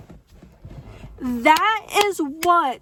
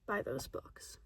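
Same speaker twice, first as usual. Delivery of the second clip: mumbled aside; 12 semitones lower, 16 dB quieter than usual. Real, not acted. Trailing confession.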